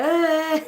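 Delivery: loud, high groan of frustration; frustrated ugh. Retold or imitated. Retelling the failed app export, loud frustrated ugh. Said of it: "I was like".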